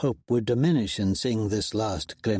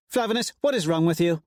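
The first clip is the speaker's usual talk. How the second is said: snapping; 7 semitones higher, faster than usual. A narrator's reading. Pyther to Roan, snapping. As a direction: snap at him. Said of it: "Pyther snaps".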